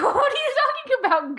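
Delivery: high-pitched bewildered comeback; baffled reaction. Retold or imitated. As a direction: reenact your own disbelief, voice jumping up high. Acts out her baffled reply.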